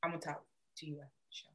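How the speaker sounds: muttered very softly and fast; muttered aside. Real, not acted. Telling her friend to talk after the show, in a mutter. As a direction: mumble it so only she hears. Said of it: "You what?".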